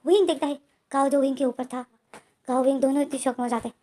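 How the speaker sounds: ordinary delivery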